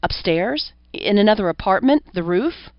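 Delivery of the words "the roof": usual speaking voice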